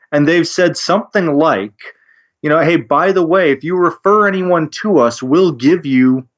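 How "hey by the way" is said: usual speaking voice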